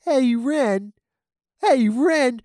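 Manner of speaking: Stimpy calling to Ren, high and loud; Stimpy impression. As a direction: call out like a dopey cartoon cat. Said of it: "I don't know if that's a good Stimpy impression".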